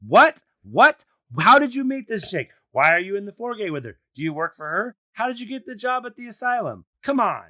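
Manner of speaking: loud, high-pitched, incredulous questions; pissed-off rant. Real, not acted. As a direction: fire off outraged questions, voice jumping up. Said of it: "I'm fucking pissed".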